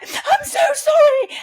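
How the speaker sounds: high, loud, slow sobbing plea; tearful apology. Retold or imitated. Teenage boy sobbing sorry to his mum. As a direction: cry it out as the boy begging forgiveness.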